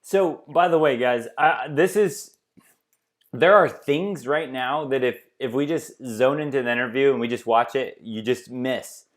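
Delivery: normal talking voice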